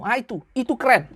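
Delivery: normal talking voice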